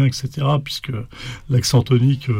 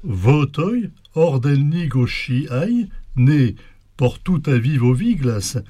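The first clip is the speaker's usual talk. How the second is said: slow, measured verse reading with lilting pitch; poem recitation; 3 semitones higher, slower than usual. Acted. Speaker declaims the translated Béguine poem to the host, poem recitation. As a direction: declaim it line by line, pausing between phrases.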